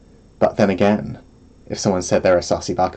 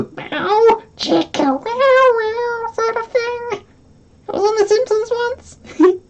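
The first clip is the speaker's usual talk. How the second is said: high slow mock jingle voice; slower than usual. Imitating the sexy music sting.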